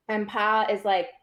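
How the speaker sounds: ordinary talk, nothing marked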